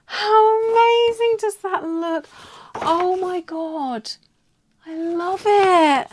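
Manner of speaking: high, drawn-out squeal of delight; excited squeal. Real, not acted. Vlogger seeing her stamp come out, ecstatic delight. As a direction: gush slowly and loudly with joy. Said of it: "so, so excited".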